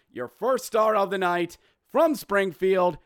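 normal talking voice

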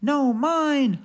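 hollering squirrel. Acted. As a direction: holler it back. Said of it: "hollered Bruce".

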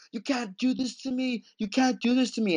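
high pleading cry, imitating Freddie; mock begging. Podcaster retelling Freddie's helpless plea, high and begging. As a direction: beg desperately in a high voice. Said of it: "he begs and he begs".

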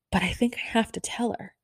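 whispering to herself, nervous; half-whispered private resolve; whispered thought. Retold or imitated. Fan whispering to herself in the meet-and-greet line.

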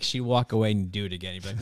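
usual speaking voice

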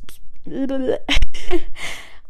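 loud burst of laughter after a flub; real laugh at own slip; blooper laugh. Real, not acted. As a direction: stumble on a word and crack up loudly. Flubs a word, laughs it off.

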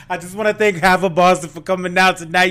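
mock stage announcer. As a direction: address the crowd like a club host. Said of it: "She gets on stage, takes the mic".

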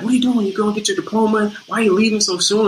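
high, fast, mock-angry questions; mad scolding. Co-star storms in scolding him, high and fast. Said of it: "Comes in mad".

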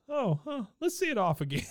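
lightly voiced, high, jolly British gentleman; a hint of a pompous old English gent; posh gent impression. Retelling the puppet gentleman to a co-host, lightly voiced jolly Brit. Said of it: "He goes".

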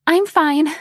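high, squeaky reply. A narrator's reading. Flustered guest answering her host, squeaky. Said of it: "answered in a squeaky voice".

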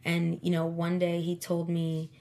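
usual speaking voice

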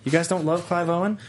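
put-on British accent. Host needling co-hosts in British accent. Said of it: "We don't like your accent of Clive Owen".

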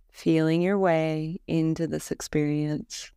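calm, measured Yoga Nidra guidance with long pauses; guided meditation. Acted. Teacher leading a listener through a Yoga Nidra body scan, guided meditation voice. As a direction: lead them gently into rest, leaving space between phrases.

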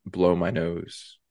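normal talking voice

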